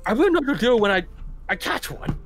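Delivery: a hint of the Joker, rising; lightly voiced Joker quote; Joker impression. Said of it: "you do a good impression".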